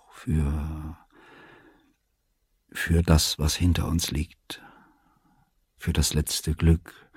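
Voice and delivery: soft, halting, emotional thanks; bewegt. A narrator's reading. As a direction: falter between phrases, slowing and fading. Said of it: "Jetzt zitterte seine Stimme vor Erregung". (leise, stockend, tief bewegt)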